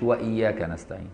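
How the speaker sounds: وإياك نستعين with the و clearly said; correct with waw. Shown as a demonstration. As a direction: keep the و before إياك. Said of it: "ما قالش".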